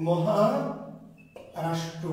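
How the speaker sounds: Maharashtra stretched out very slowly, syllable by syllable; slow, drawn out. Shown as a demonstration. Teacher repeating Maharashtra for students, very slowly drawn out. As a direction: spell out Maharashtra slowly so students catch the name.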